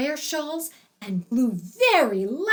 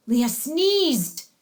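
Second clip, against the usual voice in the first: plain reading voice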